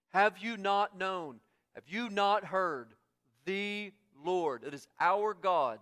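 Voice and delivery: loud, insistent preaching with weighty key words; impassioned preaching. Acted. Preacher proclaiming Israel's personal God, impassioned preaching. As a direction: proclaim it to the whole congregation.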